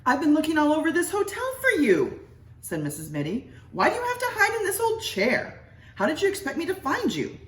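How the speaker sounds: high, shrill-pitched scolding wife voice; nagging wife. Acted. Missus Mitty scolding her husband in the hotel, high nagging. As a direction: scold him like an impatient wife.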